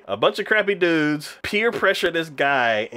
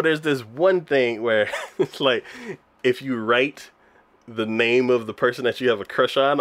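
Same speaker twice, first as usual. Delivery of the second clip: ordinary talk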